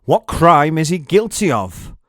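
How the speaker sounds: Pilate asking. A narrator's reading. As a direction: call out over the crowd. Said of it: "Pilate asked the crowd".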